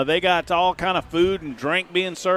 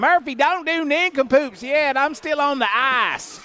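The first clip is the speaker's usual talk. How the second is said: high, slow Southern granny mimicry; Jackson; 9 semitones higher than usual. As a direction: imitate a grandma calling out in protest. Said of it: "that's my Jackson impersonation".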